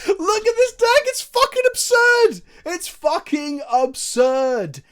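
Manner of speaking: high, loud squeal of disbelief, easing slightly; gleeful shrieking. Real, not acted. Gleeful outburst at his combo.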